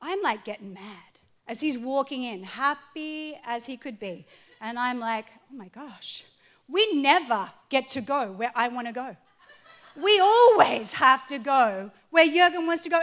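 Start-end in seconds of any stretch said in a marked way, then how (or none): 5.5-13.0 sulky complaint. Retold or imitated. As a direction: grumble that swells into a wail. She sulks about husband's choice.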